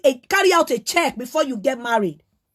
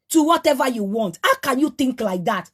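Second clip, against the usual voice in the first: ordinary talk, nothing marked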